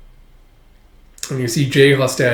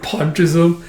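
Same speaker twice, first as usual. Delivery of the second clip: plain reading voice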